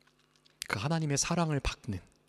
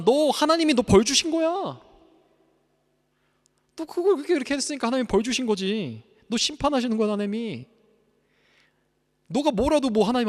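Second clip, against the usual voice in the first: higher, louder sneering accuser; Satan's taunting voice; 8 semitones higher, 6 dB louder than usual. Acted. Satan accusing a believer, sneering and taunting. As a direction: taunt the sinner with contempt. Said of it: "사단이 주는 거짓말이에요".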